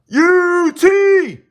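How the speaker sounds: high shouted chant call; rowdy party animal. Real, not acted. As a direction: yell it like a stadium chant. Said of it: "I'm gonna say it one time".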